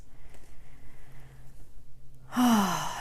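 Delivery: long exhaled "ahh" sigh; airy, falling meditation sigh; letting-go sigh. Shown as a demonstration. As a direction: breathe out slowly, releasing thoughts. Releasing thoughts during meditation.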